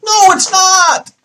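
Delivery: very high, loud, drawn-out yell; outraged shout. Acted. Vlogger rebutting the publisher's excuse, outraged yell. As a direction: explode in mock outrage, stretching the words.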